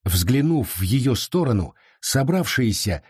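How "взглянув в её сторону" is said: usual speaking voice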